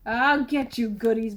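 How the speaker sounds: plain reading voice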